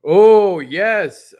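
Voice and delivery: a sudden loud, thrilled oh yes; real delighted yelp; excited shout. Real, not acted. Excited reaction to Block Explorers news.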